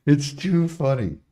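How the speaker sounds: high laugh then giggly line. Real, not acted. Laughing at his rant.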